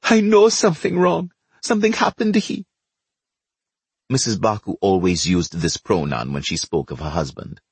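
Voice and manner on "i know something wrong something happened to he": high-pitched distressed wife; a touch of tearful panic; mildly tearful. Acted. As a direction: wail it, pitched well up, half in tears. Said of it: "burst into tears right away".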